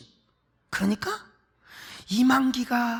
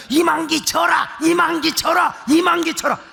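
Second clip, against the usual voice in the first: higher, raised cheering chant; mock shouting cheer; 7 semitones higher than usual. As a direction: cheer on the wrestler like a rowdy fan. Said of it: "막 소리를 더 지르는 거예요". (흉내 낸 높은 응원 구호)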